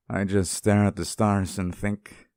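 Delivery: usual speaking voice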